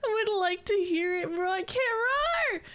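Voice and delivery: high, squealing through laughter; laughing protest. Real, not acted. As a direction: protest while cracking up.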